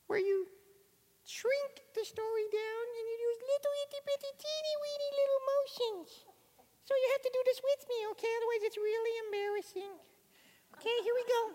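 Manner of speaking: very high, tiny voice, like a little mouse; tiny squeaky voice. Shown as a demonstration. Teacher shrinking her voice down to a tiny squeak. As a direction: talk like the smallest creature. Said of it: "the teeny weeny itsy bitsy version".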